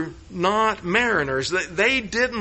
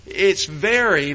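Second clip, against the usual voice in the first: usual speaking voice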